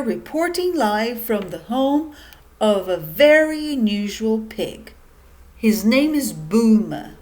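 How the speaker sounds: loud, punchy TV reporter; news reporter. Acted. Reporter opening a special news flash to pigs, loud broadcast voice. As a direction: announce it like a live news flash.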